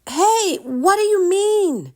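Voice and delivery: put-on high, loud boyish yell. A narrator's reading. Drake yelling after the soldier running upstairs. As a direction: shout after someone leaving. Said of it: "Drake yelled".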